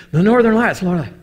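excited shout. Retold or imitated. Preacher calling shipmates to see the Northern Lights, thrilled shout. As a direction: yell it out, freaking out with joy.

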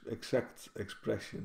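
ordinary talk, nothing marked

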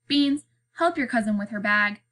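ordinary delivery, nothing marked

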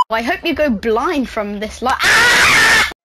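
crying frustrated rage shout; rage-shout it near tears; raging gamer. Real, not acted. Raging at an opponent.

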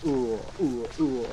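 slow, groaning monster moans; Nemesis groan. Retold or imitated. Player imitating Nemesis slowing down, groaning. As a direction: moan like a slowed, hurt Nemesis. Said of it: "slows down and goes".

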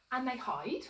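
usual speaking voice

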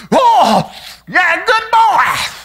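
put-on very high, slow old lady coo; great-grandmother cooing. Great-grandmother praising the child after a kiss, high slow coo. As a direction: drag out the doting coo. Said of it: "She'll lay a sugar on my cheek".

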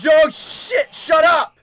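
high, loud outburst; startled yell. Real, not acted. Player jolted by game noise, startled yell. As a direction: yell at it to stop, rattled.

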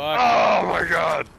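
slow panicked oh my god; real shock, drawn out; startled shout. Real, not acted. Caught by the chaser.

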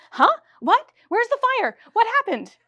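high, loud, startled questions; startled men. Acted. Startled men jumping up mid-reading, calling out high and loud. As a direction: jolt awake and blurt out in alarm. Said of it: "They leapt to their feet".

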